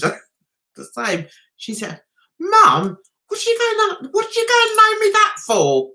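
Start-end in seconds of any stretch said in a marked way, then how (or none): 2.4-5.9 high-pitched mock complaint, rushing at the end; imitated indignant daughter. Daughter objecting to mum about her middle name, mock indignant. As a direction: protest like an affronted daughter, pitched right up.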